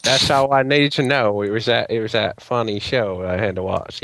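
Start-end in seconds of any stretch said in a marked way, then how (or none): 1.4-4.0 slow, badly faked Texas drawl; bad Texas accent. Co-host ending the syndication argument, bad Texas accent. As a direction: put on a terrible Texas accent, slowly. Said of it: "That was the worst Texas accent ever".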